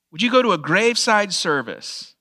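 usual speaking voice

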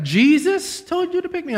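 pitched far up in disbelief; astonished echo of the claim; surprised question. Retold or imitated. Hitchhiker stunned that Jesus told him to pick me up, high surprised.

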